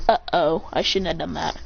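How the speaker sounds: low, softening uh-oh; sheepish dread. Acted. Sakura hearing the ground crack, sheepish regret. Character realises her mistake.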